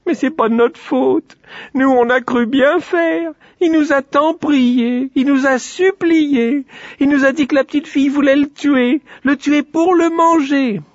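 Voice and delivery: acted sobbing plea, stretched and shrieky; tearful wailing. A narrator's reading. Two little girls excuse themselves to father, crying. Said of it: "se mirent à pleurer".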